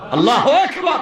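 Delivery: ringing preacher's exclamation; exclaimed takbir. Real, not acted. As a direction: call it out to rouse the crowd.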